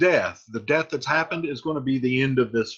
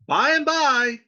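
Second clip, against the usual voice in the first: high, loud call toward the door; 11 semitones higher, 6 dB louder, slower than usual. Acted. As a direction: shout it out to whoever is knocking. Friar calls to the knocker.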